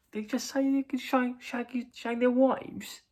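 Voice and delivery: mock astonishment at what was heard; acted disbelief. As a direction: repeat it high-pitched, hardly believing it. Acting lads' disbelief at song.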